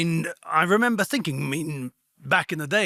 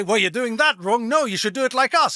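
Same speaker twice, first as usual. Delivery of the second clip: higher, mildly raised know-it-all voice; imitating a preachy outsider; mock lecturing; 7 semitones higher, 4 dB louder than usual. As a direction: play the smug foreigner telling them they're wrong. Retelling foreigners lecturing Hungarians.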